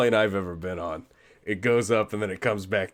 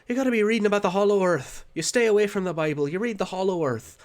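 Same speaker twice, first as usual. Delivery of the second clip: mock grandmother; 10 semitones higher, 4 dB louder than usual. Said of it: "his grandmother was telling him".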